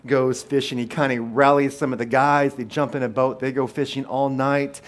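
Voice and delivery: loud, driving sermon delivery; preaching at full force. Acted. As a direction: preach it out to the whole room.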